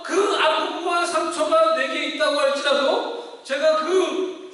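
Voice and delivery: loud, high, impassioned preaching; fervent sermon climax, rising and loud; preaching at full force. Acted. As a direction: drive it home to the congregation.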